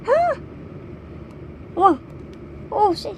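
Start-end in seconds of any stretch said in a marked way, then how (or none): 0.1-1.8 high breathy cry. Real, not acted. Reacting to photobook.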